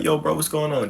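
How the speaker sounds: high-pitched, upbeat buddy greeting; casual phone greeting. Acted. Friend calling a friend from a trip, acted upbeat greeting. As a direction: act out hailing a friend on the phone.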